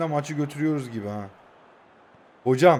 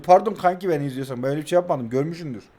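ordinary delivery

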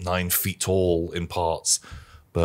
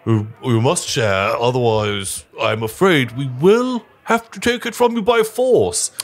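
stern matron; 4 semitones higher, 4 dB louder than usual. Acted. Matron warns adventurers she will take their food.

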